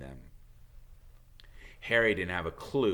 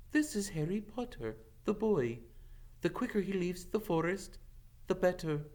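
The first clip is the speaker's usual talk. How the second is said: a higher, steady voice for the centaur; centaur Firenze; 10 semitones higher than usual. Acted. Firenze answering Bane's anger, higher and firm. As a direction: answer firmly, standing your ground.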